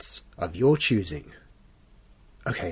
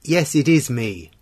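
loud, raised voice into a phone; shouting down a bad line; 2 semitones higher, 5 dB louder than usual. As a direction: shout to be heard on a crackly call.

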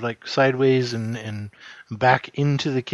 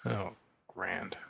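whispered aside; 5 semitones lower, 13 dB quieter than usual. Acted. Sarcastic aside before answering door.

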